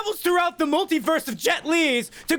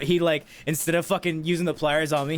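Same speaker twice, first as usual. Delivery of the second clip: ordinary talk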